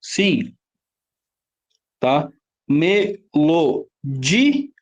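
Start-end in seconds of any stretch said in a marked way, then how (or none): 2.7-4.7 "Melodia" very slowly split as Me - lo - DI - a; slow teacher's chunking of "Melodia"; syllable by syllable. Shown as a demonstration. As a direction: sound out "Melodia", pausing between syllables. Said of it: "é a penúltima?".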